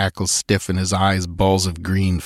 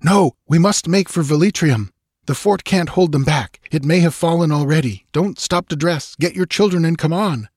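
raised, pressing voice; urgent young man; 9 semitones higher than usual. A narrator's reading. Balthus urging the woman to flee now, raised and urgent. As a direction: hurry her along firmly.

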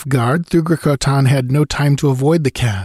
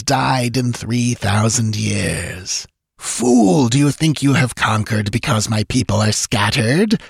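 hissing, gloating ancient sorcerer; serpent-like hiss; 2 semitones higher than usual. Acted. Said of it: "The voice was like a hiss of a giant serpent".

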